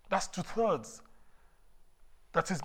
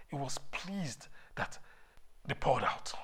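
whispered awe; 2 semitones lower, 6 dB quieter than usual. Acted. Preacher drops to whisper.

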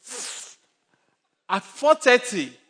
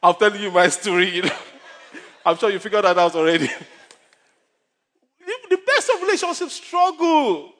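chuckling, louder through the laugh; 5 semitones higher, 4 dB louder than usual. Real, not acted. Pastor laughing as he admits it is his story.

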